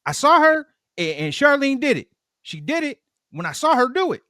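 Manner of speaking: squeaky-high put-on baby voice; baby snitch. Bribed baby rats out Charlene to Earl, squealing. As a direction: tattle eagerly in a very high little voice. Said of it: "Earl bribes the baby".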